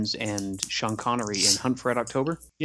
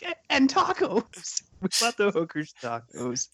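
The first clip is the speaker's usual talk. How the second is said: higher, slow pleading; hammed-up earnest captive; 6 semitones higher than usual. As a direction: beg sincerely like a captive. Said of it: "He's like".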